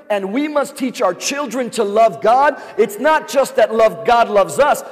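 rising call to love God with all; preaching at full force. Acted. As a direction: drive the sermon to its peak. Preaching climax, rising intensity.

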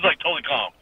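ordinary talk, nothing marked

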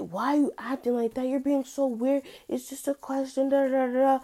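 high, mocking imitation of the pushy asker; exasperated mock voice of the person prodding her. Mimicking the person pestering her.

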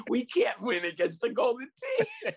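high, loud, laughing fake sob; mock sobbing wail. Coach fakes despair to his T-ball team, sobbing wail. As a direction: wail it like all hope is lost. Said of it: "I started sobbing".

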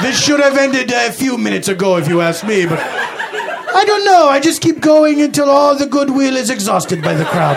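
lightly hammed Dracula-style voice; vampire-like accent. [name] answers Randy's jab, mild vampire accent. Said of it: "borderline offensive accent".

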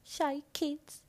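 high, small good-pupil voice; cute shy kid. Acted. Describing shy straight-A kids, high cutesy kid voice. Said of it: "we were, like".

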